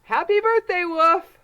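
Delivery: high, loud festive greeting; cheerful birthday shout. Acted. The animals greeting the wolf, high and loud.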